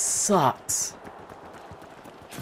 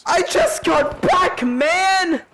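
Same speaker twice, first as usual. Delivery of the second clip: exasperated; much slower than usual. Real, not acted. Killed again right after return.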